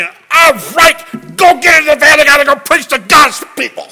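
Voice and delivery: loud, high furious yell; angry shout. Retold or imitated. Angry pastor orders his family into the van, shouting. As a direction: bark it at the whole family.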